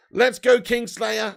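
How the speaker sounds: loud, slow cheer of a viewer's name; stretched-out rallying shout; hype call. Real, not acted. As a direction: belt it out like a chant.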